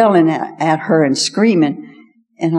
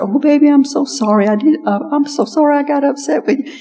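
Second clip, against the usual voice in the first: higher, quick, softened apology; lightly voiced husband saying sorry to his wife; calmed husband; 7 semitones higher, faster than usual. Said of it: "he calmed down".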